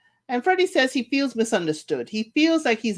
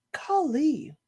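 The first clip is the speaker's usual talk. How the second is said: ordinary talk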